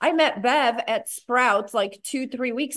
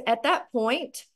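ordinary talk, nothing marked

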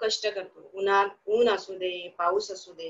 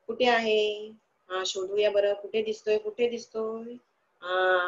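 normal talking voice

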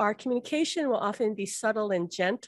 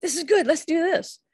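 much higher, eager cheering on; excited encouragement; 8 semitones higher than usual. Retold or imitated. Loved one from the other side urging you on, much higher and excited. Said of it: "a sign from your loved one saying".

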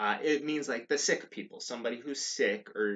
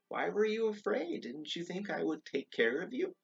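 high, light quoted question; voiced Jesus questioning; 10 semitones higher than usual. Jesus to woken disciples, questioning. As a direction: lift the voice to quote him.

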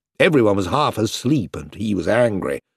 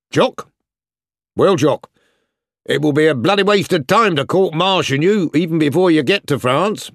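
stern army officer hiding a smile; sergeant major; 6 semitones higher than usual. A narrator's reading. Sergeant major voiced, stern joke.

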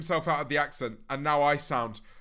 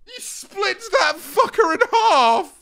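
excited shout; 14 semitones higher, 7 dB louder, slower than usual. Real, not acted. Reacting to clip, mimicking.

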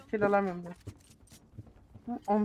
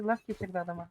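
ordinary delivery

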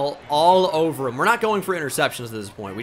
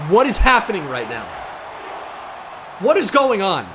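loud, higher cry of disbelief; disbelieving shout; 4 semitones higher, 4 dB louder than usual. Real, not acted. Gamer yelling as the comeback unfolds, disbelieving shout. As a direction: yell it at the screen. Stunned by the touchdown.

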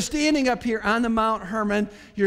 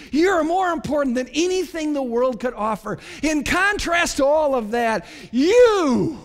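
building proclamation; 3 semitones higher than usual. Acted. Disciples put Jesus above all the world offers, building to a shout. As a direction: hammer each "You are", building to a shouted peak.

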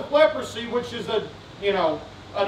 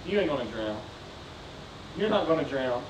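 very low, soft reply; quiet reassurance; 12 semitones lower, 10 dB quieter than usual. Acted. Preacher answers the cry of drowning, quietly reassuring. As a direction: drop right down to a hushed, low reassurance.

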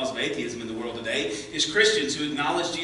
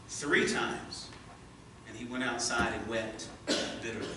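raised, dramatic Bible reading voice; scripture reading; 3 semitones higher, 4 dB louder than usual. Acted. Preacher reading Matthew's account to the church, dramatic and raised. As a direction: read the Gospel passage aloud to the congregation.

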